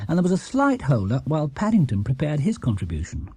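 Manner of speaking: ordinary delivery, nothing marked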